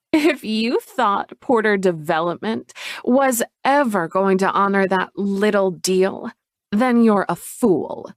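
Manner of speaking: an ugly smile in the voice; sneering Chloe. Acted.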